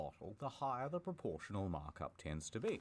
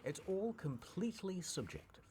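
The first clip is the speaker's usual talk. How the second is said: ordinary delivery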